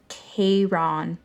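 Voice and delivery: "Charon" drawn out slowly, as KAY-ron; slow pronunciation. A narrator's reading. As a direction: spell out "Charon" by sound, two long syllables. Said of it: "Say it with me".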